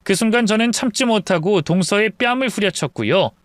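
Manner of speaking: ordinary delivery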